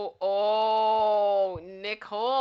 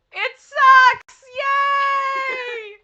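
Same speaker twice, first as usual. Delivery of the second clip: shrieked cheer, much higher and louder; excited shout; 13 dB louder, much slower than usual. Real, not acted. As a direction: scream it in triumph. Cheering the correct guess.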